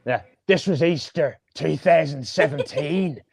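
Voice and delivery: put-on storyteller in an Irish accent; lightly put-on Irish accent. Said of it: "I'll do it in an Irish accent".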